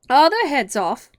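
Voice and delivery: high, very loud, slow question; shouted. A narrator's reading. Queen checking on the soldiers, shouted. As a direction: shout it across the garden. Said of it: "shouted the Queen".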